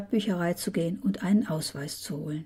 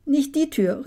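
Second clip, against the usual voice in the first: higher, urgent call; quick raised-voice warning; calling after someone; 7 semitones higher, 4 dB louder than usual. A narrator's reading. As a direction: call out to stop someone rushing off. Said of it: "rief ich noch".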